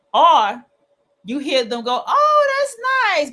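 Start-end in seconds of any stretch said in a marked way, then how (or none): 2.1-3.3 high, bright fake-nice "Oh, that's nice" with a forced upward swoop. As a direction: sound falsely sweet, hiding annoyance. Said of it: "you can feel ... Something ain't right".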